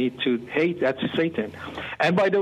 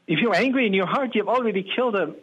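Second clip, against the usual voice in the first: quick rebuke; 7 semitones higher, faster than usual. Retold or imitated. God's reply quoted.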